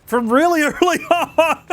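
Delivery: high, breaking into real laughter; giggling mid-sentence; laughing through it. Real, not acted. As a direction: try to go on but the laugh wins. Laughing after a cough.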